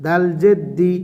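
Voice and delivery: ordinary talk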